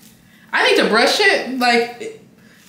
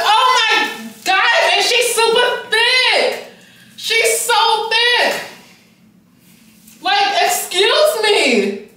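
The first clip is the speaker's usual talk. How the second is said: very high, drawn-out squealing disbelief; slow, shrill-pitched gushing that climbs higher; overstimulated excitement; 11 semitones higher, much slower than usual. Real, not acted. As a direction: stretch each phrase into a high, thrilled squeal. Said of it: "I'm getting overstimulated".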